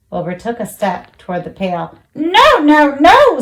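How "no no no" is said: high, louder goose cry; a rising goose protest. A narrator's reading. Goose stopping Wilbur, high and loud. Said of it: "said the goose".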